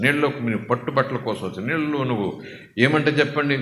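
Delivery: ordinary talk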